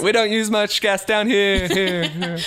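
high mock mole-person voice with echo; playful high-pitched hole dweller; mole people replying. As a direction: call back up from underground, amused. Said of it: "They're like".

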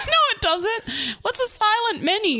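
high laughing disbelief; incredulous. Real, not acted. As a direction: scoff in amused disbelief.

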